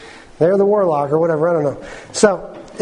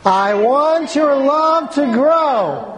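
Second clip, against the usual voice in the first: slow, higher and louder recital; 5 semitones higher, much slower than usual. Shown as a demonstration. Preacher rallying the congregation, louder and slower. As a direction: lift the line up, slow and strong. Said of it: "just a little louder this time".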